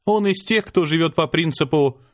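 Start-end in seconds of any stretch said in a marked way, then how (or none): none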